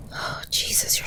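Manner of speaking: breathless alarmed whisper; worried whisper. Acted. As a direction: whisper it in fright at how cold they are. Cold, shaking partner by fire.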